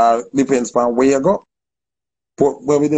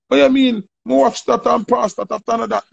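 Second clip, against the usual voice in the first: indignant retort; 8 semitones higher than usual. Retold or imitated. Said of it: "And him say".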